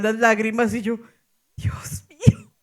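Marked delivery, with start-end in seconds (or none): 1.6-2.5 susurro agudo y asustado sin voz. Retold or imitated. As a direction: suelta un «Dios mío» en un susurro, con el alma en vilo. Ve a la chica en pánico. (susurro agudo de miedo)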